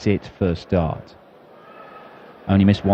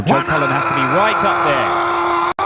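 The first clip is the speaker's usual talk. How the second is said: excited; slower than usual. Real, not acted. Commentator reacts to a maximum.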